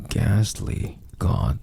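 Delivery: ordinary delivery, nothing marked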